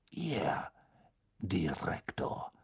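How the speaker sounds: low, slow, whispered; cold quiet potions master; Snape voice. A narrator's reading. (kalt und leise)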